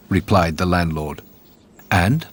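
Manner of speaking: ordinary delivery, nothing marked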